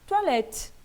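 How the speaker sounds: "toilette" as twa-lèt, stressed at the end; open E. Shown as a demonstration. Teacher giving an example word, open stressed E. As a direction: punch open -lette in "toilette", final E silent. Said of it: "que a gente pronuncia E".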